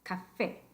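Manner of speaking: "café" with stressed FÉ; stress on final É. Shown as a demonstration. Teacher repeating the correct form, café stressed on FÉ. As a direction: put the weight on FÉ in "café". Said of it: "sino café".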